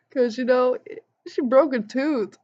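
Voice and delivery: normal talking voice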